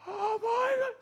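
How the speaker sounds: high, shocked oh my God; sudden dread at the sight of the needle; a high shocked cry at the needle; horrified gasp. Retold or imitated. Sees needle approaching.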